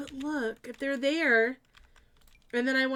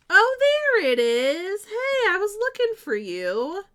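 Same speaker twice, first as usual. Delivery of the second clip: delighted; 11 semitones higher, 10 dB louder than usual. Real, not acted. Greeting lost hallway light.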